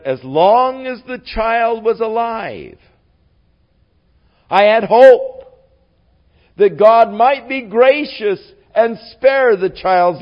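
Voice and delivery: high, loud, emphatic acted reply; impassioned explanation. David explains his hope to the servants, impassioned. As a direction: voice lifted and pushed, playing David's plea.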